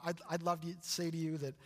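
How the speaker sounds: ordinary talk, nothing marked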